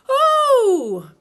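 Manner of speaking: loud high Oh as he flies up; Piglet's loud high cry of alarm; startled Piglet cry. A narrator's reading. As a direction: yelp as he's tossed upward. Piglet bumped in the air.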